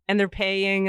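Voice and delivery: usual speaking voice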